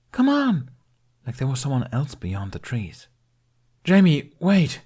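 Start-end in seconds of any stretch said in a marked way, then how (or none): 0.1-0.7 put-on high, loud child calling ahead; excited boy urging his friend on; eager call. Jamie runs ahead excitedly. 3.8-4.8 loud, high alarmed shout; calling out. Acted. Jack calls after running boy.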